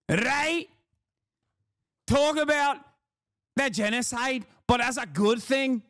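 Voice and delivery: high-pitched parody of a Scottish campaigner; squeaky, sarcastic Glasgow accent; mock Scottish accent. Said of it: "you doing that accent".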